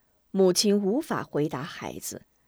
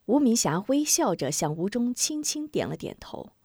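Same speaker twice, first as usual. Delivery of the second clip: plain reading voice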